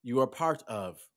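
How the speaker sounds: ordinary delivery, nothing marked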